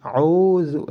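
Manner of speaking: "auzu" with the u held two beats; long, steady u in "auzu"; lengthened vowel. Shown as a demonstration. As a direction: stretch the middle u of "auzu". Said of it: "dipanjangkan dua harokat".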